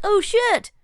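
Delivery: high, surprised squeal of recognition; shocked recognition. Retold or imitated. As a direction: react like you just spotted a favourite actor. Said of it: "So I was like".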